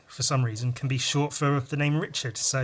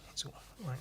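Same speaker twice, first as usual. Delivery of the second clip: usual speaking voice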